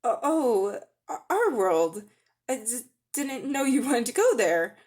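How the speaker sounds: flustered stammer on the first sounds. Acted. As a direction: stumble over the words, embarrassed.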